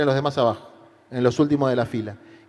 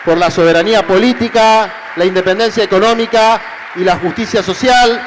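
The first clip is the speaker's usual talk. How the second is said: high, loud rally oratory; booming balcony speech; 7 semitones higher, 7 dB louder than usual. Real, not acted. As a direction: build to a roaring crowd-rousing close. Rally speech climax to crowd.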